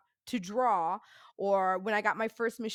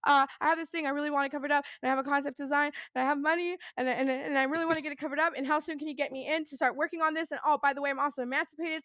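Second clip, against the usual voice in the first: rapid, higher-pitched imitated nervous rambling; nervous motor-mouth; 4 semitones higher, faster than usual. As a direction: run on without stopping, acting out nerves. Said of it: "going three hundred miles an hour".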